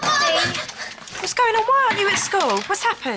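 startled mother rushing in; alarmed. Acted. Mother alarmed by her child.

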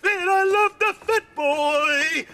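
high, slow, swooning voice; gushing admirer. Retold or imitated. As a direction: croon it like a lovestruck woman. Reads her gushing reply in voice.

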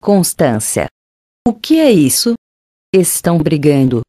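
put-on an aunt shocked to find the girls fighting; understated alarmed outcry; startled cry. A narrator's reading. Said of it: "gritou com espanto".

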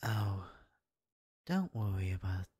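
usual speaking voice

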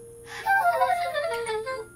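high, broken stressed laugh; a cracked nervous laugh; strained laugh. Acted. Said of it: "That wasn't a laugh".

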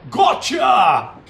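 long, loud drawn-out crow; triumphant shout. Pretend. Commentator celebrates a kill, shouting in triumph.

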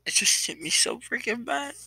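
choked-up tearful voice breaking upward; crying. Real, not acted. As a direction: voice cracking high through real tears. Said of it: "I'm really sorry for crying".

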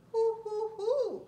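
high, loud hooting; owl hoots. Shown as a demonstration. Reading to a child, hooting like an owl. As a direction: hoot like an owl. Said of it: "like an owl".

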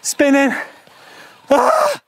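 panicked. Real, not acted. Losing control on steep slope.

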